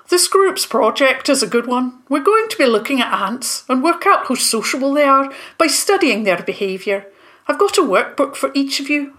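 a professor briefing pupils on a field trip; Professor Toadspawn. Acted.

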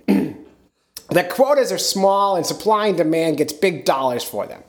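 high, drawn-out weird Maine captain; Captain Sean. Acted. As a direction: squawk it like Gilbert Gottfried from Maine. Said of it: "like some weird Gilbert Godfrey from Maine".

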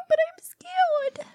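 high, slow, whiny scared voice; mock scared. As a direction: play scared like a small child. Playing scared of the story.

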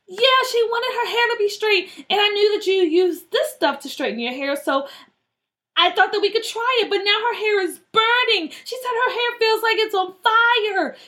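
high-pitched, anxious confession; panicked explaining. Acted. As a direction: explain yourself while panicking for a friend.

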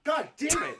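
hammed-up yelled curse; mock shout. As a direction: shout it in fake outrage.

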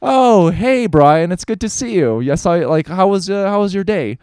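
friendly greeting imagined for the dad. Retold or imitated. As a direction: play the friendly dad, lightly. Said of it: "you could have just as easily said".

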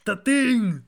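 high, loud, hammed-up character voice; showy mock superhero impression; The Thing. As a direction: announce yourself in a put-on cartoon voice. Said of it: "Be like me. The Thing".

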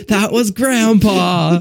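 stretched-out pretend anguish; mock dismay. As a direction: wail it in hammed-up horror. Imagines relatives after urn is spilt.

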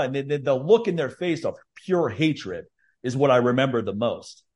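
usual speaking voice

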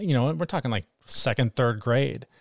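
normal talking voice

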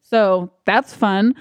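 ordinary delivery

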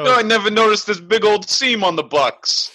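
high, hammed-up Bronx caricature; comedy Bronx accent. Co-host piling on the professor impression, comedy Bronx accent. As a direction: exaggerate the working-class Bronx accent. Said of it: "Bronx accent or, like, some kind of working class accent".

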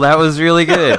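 high, loud laugh through words. Real, not acted. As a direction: still laughing while praising. Laughing at partner's joke.

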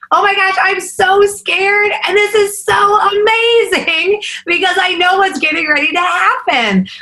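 scared but thrilled, voice way up. Retold or imitated. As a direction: gush it high, stretching the key words. Tells husband of scared excitement.

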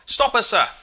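high, loud cry of alarm; startled sailor calling from afar; startled distant shout. A narrator's reading. Unseen sailor calling afar in the dark, startled shout. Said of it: "a startled voice saying afar in the dark".

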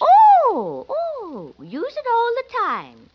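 high squealing delight; delighted gush. Acted. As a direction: gush over it, thrilled. Landlady gushes over mustache wax.

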